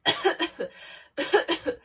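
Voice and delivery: short sharp cough from the diaphragm; demo cough. Shown as a demonstration. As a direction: cough to feel the muscle. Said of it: "if you do a cough".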